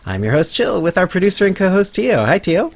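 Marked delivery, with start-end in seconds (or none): none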